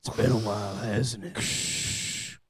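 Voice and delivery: tough movie hero. Pretend. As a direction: play it like a gruff loner. Mock movie scene dialogue.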